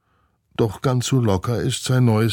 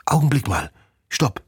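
higher, firm stop; chimp girl halting; 6 semitones higher than usual. Acted. Chimp Nina stops the glider squirrel's rush. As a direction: cut her off, a touch raised. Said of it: "sagt Nina".

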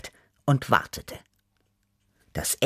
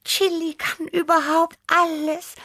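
very high, awestruck cat voice; little cat full of admiration; admiring. A narrator's reading. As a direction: marvel in a squeaky high voice. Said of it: "sagte Tibi bewundernd".

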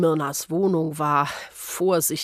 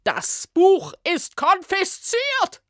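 shrill, much higher and louder; outraged official; 12 semitones higher, 4 dB louder, slower than usual. Acted. Ministry official catching the witch reading, shrill outrage. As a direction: screech the outrage at her.

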